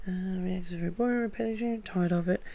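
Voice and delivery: quiet, quick mumble to herself; muttered recap. Real, not acted. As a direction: softly run back over the points under your breath. Mumbles back over her points.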